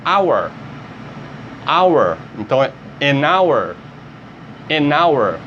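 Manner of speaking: hour with a silent H, said like our; no H at all in hour; English pronunciation. Shown as a demonstration. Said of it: "Eu vou ler isso aqui como". (hour sem o som do H)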